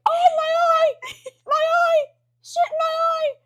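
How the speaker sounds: very high, loud mock wail; hammed-up high yelp of pain; coach's pained cry. Acting out coach hit in eye.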